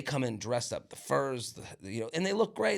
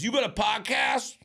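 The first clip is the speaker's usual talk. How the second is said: mock drunk guy; 7 semitones higher than usual. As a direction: belt it out like a hammered guy at a bar. Drunk stranger voiced in story.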